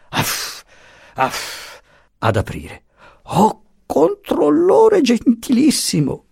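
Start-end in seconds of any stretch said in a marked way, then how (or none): 0.1-1.8 soft voiceless puffs; puffing. A narrator's reading. Little master comes down to open, puffing. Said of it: "venne giù soffiando". 3.2-6.3 very high, slow, plaintive greeting; pathetic little man. A narrator's reading. Puttini greets the controllore at the door, pathetically. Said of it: "alzando pateticamente le sopracciglia".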